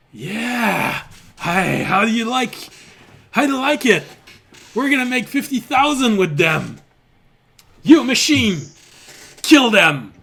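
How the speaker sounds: high, loud mocking yell; jeering rogue. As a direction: cheer the machine on to kill. GM voices rogue ordering the kill.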